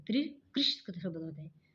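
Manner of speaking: ordinary talk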